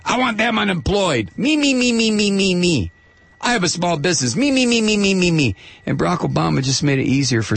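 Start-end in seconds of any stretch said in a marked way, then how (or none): 0.1-5.6 mocking selfish right-winger. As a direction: mimic a self-centred right-winger in a high chant. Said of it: "these right-wingers. I mean, you know, it's".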